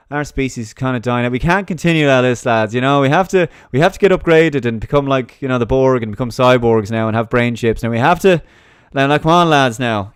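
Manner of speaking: rally them like a mocking cheerleader for cyborgs; sarcastic rousing speech, loud and high; mock rallying cry. Mock globalist rallying the lads.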